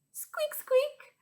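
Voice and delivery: very high little squeaks; mouse squeak. Pretend. Teacher voicing a mouse for children, high squeaking. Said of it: "Hear that funny sound?".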